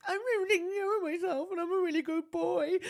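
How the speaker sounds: high, laughing mock confession; laughing while talking. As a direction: giggle through it. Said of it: "being like".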